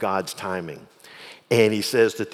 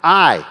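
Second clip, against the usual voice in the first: "I" said loud, higher and on its own; loud, raised, emphatic "I"; 4 semitones higher, 7 dB louder than usual. Shown as a demonstration. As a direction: punch "I" as a standalone word. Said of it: "it's emphatic".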